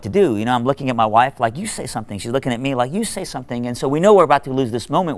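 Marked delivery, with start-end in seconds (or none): 1.6-2.2 mock hushed urging to a partner; hushed aside. Couple silently pass the task. 2.9-3.7 higher, hushed mock urging; hushed aside. Couple silently pass the task.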